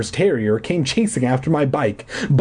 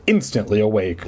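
ordinary delivery, nothing marked

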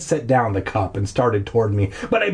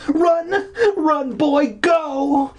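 high, hammed-up cries to flee; urgent yelling; much slower than usual. Boy shoving his dog away, yelling urgently. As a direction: yell it high and frantic.

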